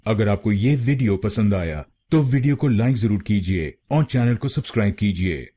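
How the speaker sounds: usual speaking voice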